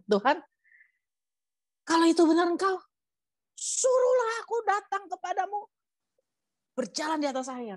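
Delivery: high-pitched acted call to the Lord; high, pleading storybook cry; Petrus calling out. A narrator's reading. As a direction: cry out across water, falling away at the end. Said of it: "Petrus berseru dan menjawab dia".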